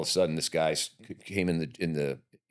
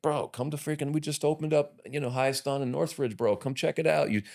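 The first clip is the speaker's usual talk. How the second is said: buddy's voice; 8 semitones higher than usual. Retold or imitated. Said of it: "he was like".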